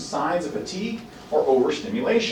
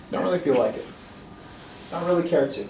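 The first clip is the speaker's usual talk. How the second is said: softer, half-hearted refusal; mock indifferent child; 2 semitones lower, 7 dB quieter than usual. Child turning down the parent's invitation, mock indifference. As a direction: play a kid who has lost interest.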